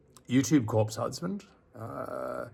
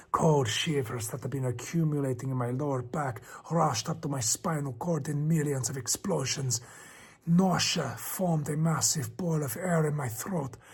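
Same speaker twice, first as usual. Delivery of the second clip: tense, lightly raised storytelling voice; story narration; 3 semitones higher, 3 dB quieter than usual. A narrator's reading. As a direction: build the dread as you read. Reads creepypasta narration.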